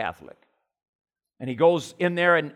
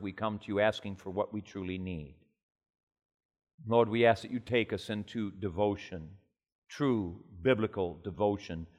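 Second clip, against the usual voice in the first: prayer; 4 semitones lower than usual. Acted. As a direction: pray humbly before God, hushed. Leads a prayer.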